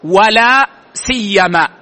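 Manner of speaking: ولا سيما said slowly, the و clearly sounded; teacher models ولا سيما with the و; pronunciation demo. Shown as a demonstration. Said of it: "وهذا أفصح ما ورد فيها". (نطق ولا سيما بالواو ببطء)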